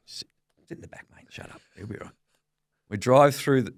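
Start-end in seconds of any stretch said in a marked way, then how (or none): 0.1-2.1 very soft, muttered brush-off; retold hushing. Son shushing Dad in the car, barely voiced. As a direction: drop almost to a whisper to shush a passenger.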